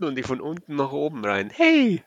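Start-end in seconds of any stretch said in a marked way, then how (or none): 1.5-2.0 excited shout. Real, not acted. Gleeful outburst.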